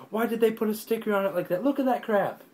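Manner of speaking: real high-pitched outrage at a sticker; exasperated. Real, not acted. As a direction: vent at the sticker ruining the box. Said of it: "It makes me mad".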